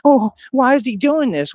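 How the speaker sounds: high, slow shocked mock voice; high mock of shocked bystanders; mocking onlookers. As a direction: parody the gasping crowd. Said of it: "everybody goes".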